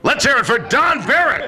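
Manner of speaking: high, loud showman intro; announcer shout. Real, not acted. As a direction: belt out the guest's name. Host introduces guest to audience.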